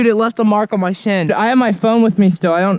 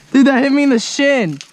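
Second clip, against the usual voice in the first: ordinary talk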